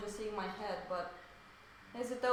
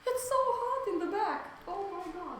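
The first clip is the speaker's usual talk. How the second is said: excited; 10 semitones higher, 5 dB louder than usual. Real, not acted. Laptop overheating, alarmed exclaim.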